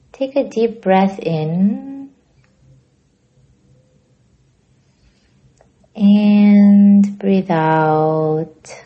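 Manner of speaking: very slow, drawn-out breathing cue. Real, not acted. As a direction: stretch each word to last a whole breath. Leading a slow breathing exercise.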